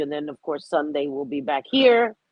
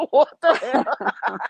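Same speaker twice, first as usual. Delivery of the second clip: laughing; 7 semitones higher, 4 dB louder, much slower than usual. Real, not acted. Laughing at the saying.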